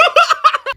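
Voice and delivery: real squealing laugh; high laugh. Real, not acted. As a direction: burst out in high-pitched laughter. Laughs at own story.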